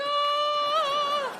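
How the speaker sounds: high, sustained angelic "ah"; sung-out celestial ahhh; mock heavenly choir. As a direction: hold one high note like a descending angel. Imitates heavenly sound of levitating.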